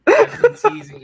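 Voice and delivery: laughing out loud; cracking up mid-sentence. Real, not acted.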